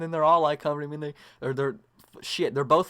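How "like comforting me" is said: ordinary delivery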